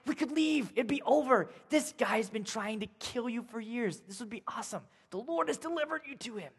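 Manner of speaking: hushed egging on from a hiding friend; hushed urging. Acted. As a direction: urge him quietly so Saul won't hear. Friend urging David to kill.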